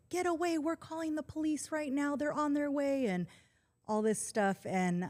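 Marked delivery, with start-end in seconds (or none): 0.1-3.1 retold yelling. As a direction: imitate alarmed friends yelling a warning. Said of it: "they're yelling at him".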